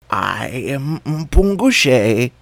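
slow, drawn-out nervous call. A narrator's reading. Jackal calling into the bushes, nervous and slow. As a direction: try to sound brave but falter. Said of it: "the jackal called out nervously".

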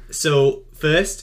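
normal talking voice

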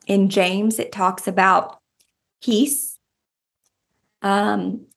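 ordinary talk, nothing marked

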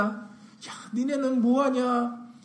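mock taunt, stretched out. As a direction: jeer at a cowering army, slowly. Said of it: "조롱합니다".

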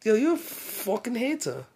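badly faked London accent, sliding toward Jamaican; mock London accent. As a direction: do a shaky attempt at a London accent. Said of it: "that shit turn to Jamaican".

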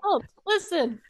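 mock Ash call. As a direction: chirp it like a tiny fairy helper.

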